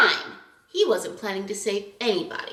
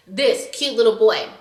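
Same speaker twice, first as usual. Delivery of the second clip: ordinary talk, nothing marked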